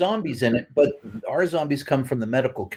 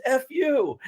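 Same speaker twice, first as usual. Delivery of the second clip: joking retort; 12 semitones higher than usual. Retold or imitated. Retold Ray's joking retort.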